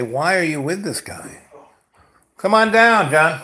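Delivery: taunting call, rising high and loud; mock taunting shout; mocking friends. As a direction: call him over like a jeering crowd. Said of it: "And people would say".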